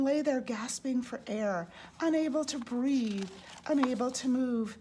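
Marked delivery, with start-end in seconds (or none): none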